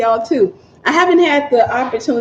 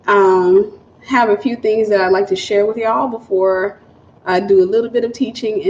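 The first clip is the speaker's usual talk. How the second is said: ordinary talk, nothing marked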